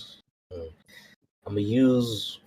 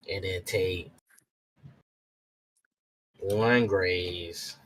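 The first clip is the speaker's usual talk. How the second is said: slow list with long pauses; listing aloud; slower than usual. Pretend. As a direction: say each item as if writing it down. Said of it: "He, like, writes a list".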